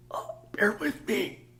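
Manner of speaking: voiceless, slow squeaky whisper; substitute teacher impression. Substitute teacher who lost their voice begs patience, whispered. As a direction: plead through a lost voice. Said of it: "This is my impression of a substitute teacher".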